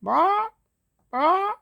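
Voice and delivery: very high rising barks, like a little bird trying to bark; chicken barking. Acted. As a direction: squeak out two high barks. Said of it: "a clucking noise".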